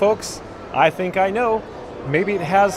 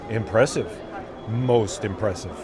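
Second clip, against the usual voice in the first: Darth Vader; 8 semitones lower, 5 dB quieter than usual. Retold or imitated. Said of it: "Darth Vader".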